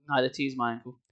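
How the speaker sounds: usual speaking voice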